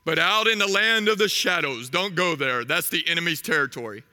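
a father solemnly showing his son the kingdom; Mufasa. Acted. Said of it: "Mufasa is standing on top of Pride Rock".

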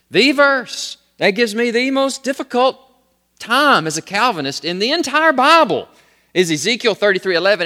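loud, high, indignant retelling; angry student. Retold or imitated. Student complaining to seminary teacher about a Bible verse, angry. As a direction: imitate an upset student's heated complaint. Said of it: "he got very angry about that".

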